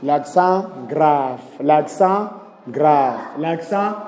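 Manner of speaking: slow, drawn-out model; slow model phrase. Shown as a demonstration. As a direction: stretch each syllable for the class.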